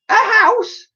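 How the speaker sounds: very high shocked squeak; horrified at the size; astonished echo. A narrator's reading.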